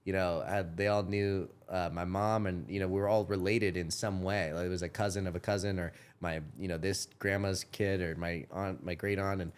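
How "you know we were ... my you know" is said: usual speaking voice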